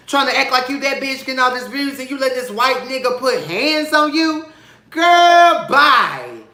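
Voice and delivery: high, loud, incredulous read; loud high-pitched "girl, bye" scolding; sassy callout. Retold or imitated. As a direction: clap back with full attitude. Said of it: "I said".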